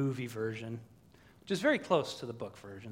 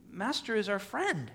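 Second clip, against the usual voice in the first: higher, protesting creature voice; Smeagol; 6 semitones higher than usual. Acted. Preacher as Smeagol defending his master, pleading. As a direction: plead it in a raised pitch. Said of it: "Smeagol says".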